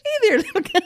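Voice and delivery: high, loud cooing to something tiny; cooing baby talk. Pretend. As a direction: coo it like talking to a puppy. Cooing to a penis joke.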